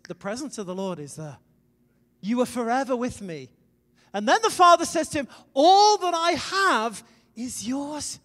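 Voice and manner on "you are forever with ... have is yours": preacher crying out the Father's words to the congregation; fervent proclamation. Acted. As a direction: proclaim the Father's promise to the son.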